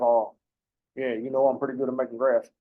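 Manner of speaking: lightly put-on Texas accent, admittedly bad; mock Texas accent. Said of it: "I can't do a Texas accent".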